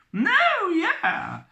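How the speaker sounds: very high squeaky woman's voice; high-pitched voice. Acted. Elizabeth answering the captain, very high squeak. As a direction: squeak the reply. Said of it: "replied the strange visitor".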